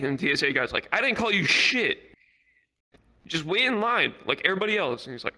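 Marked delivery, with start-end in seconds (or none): 0.9-5.1 high-pitched, insistent comeback; mock exasperation. Airport officer getting into it with passenger, mock exasperation. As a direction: imitate an officer fed up with arguing. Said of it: "they're starting to get into it".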